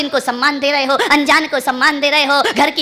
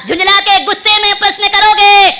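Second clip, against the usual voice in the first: sharp raised angry voice; mock angry demand, higher and louder; angry questioning; 9 semitones higher, 7 dB louder than usual. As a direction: bark the question in irritation. Preacher acts out angry asking.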